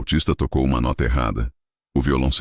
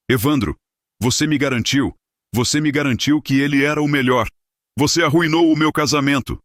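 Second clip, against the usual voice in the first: much higher, louder enraged yelling; furious shout; 13 semitones higher, 4 dB louder than usual. A narrator's reading. Host blaming Evandro for ruined wedding, furious shout. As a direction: scream it in full fury. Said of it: "Horácio estava tremendo de raiva".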